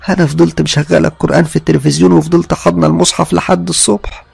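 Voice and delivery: high, agitated retelling of a scare; frightened mother-in-law. Acted. Mother-in-law tells why she fled the bedroom, frightened. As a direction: pour it out in rising alarm. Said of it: "كانت مخضوضة قوي".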